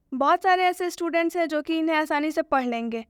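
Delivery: normal talking voice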